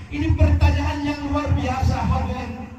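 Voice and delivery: high, rising sermon cry; impassioned preaching. Acted. Preacher to the congregation, high impassioned cry.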